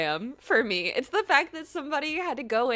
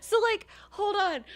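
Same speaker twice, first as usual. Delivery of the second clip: amazed shout; 7 semitones higher, 6 dB louder than usual. Real, not acted.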